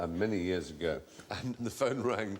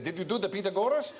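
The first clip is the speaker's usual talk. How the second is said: put-on higher, quick Hungarian accent; a light Hungarian grandfather; 7 semitones higher, faster than usual. Grandfather quizzing the boy after school, Hungarian accent. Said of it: "he said".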